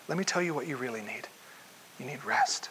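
Jesus softly telling tired disciples to rest; gentle invitation. Acted. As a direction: lean in and offer rest.

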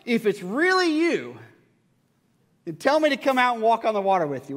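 high, loud call across distance; acted shout to someone far off. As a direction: call out boldly as Peter. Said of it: "Peter called out to him".

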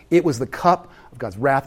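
normal talking voice

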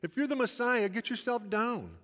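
passer-by mocking Jesus on the cross, jeering. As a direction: taunt him like a jeering bystander. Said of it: "they're mocking Jesus".

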